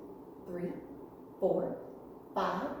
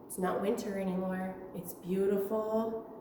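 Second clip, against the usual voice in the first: ordinary delivery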